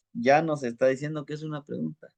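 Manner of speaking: ordinary delivery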